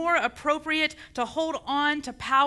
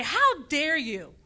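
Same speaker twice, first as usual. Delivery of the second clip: indignant preacher confronting a superior, drawn out; righteous outrage, stretching the key words; indignant confrontation. Acted. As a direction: challenge him to his face, slow and emphatic. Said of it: "look him in his face and say".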